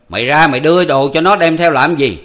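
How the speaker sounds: high, loud scolding of his wife; angry scolding. A narrator's reading. Hữu berating his wife.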